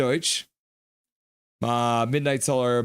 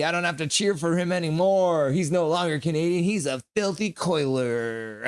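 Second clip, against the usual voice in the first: playful taunt; 5 semitones higher than usual. Acted. Streamer ribbing a rival fan about his team, playfully taunting. Mock-taunting viewer Janias.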